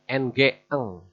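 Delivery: normal talking voice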